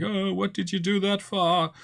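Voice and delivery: a bruised man's plaintive complaint; hurt whiny victim. Retold or imitated. Punched man on the ground complaining to the player, high whine. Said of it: "And he's like".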